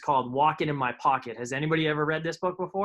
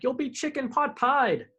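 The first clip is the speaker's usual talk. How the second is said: warning cry; 10 semitones higher, slower than usual. A narrator's reading. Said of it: "Papa cried".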